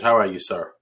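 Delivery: ordinary talk, nothing marked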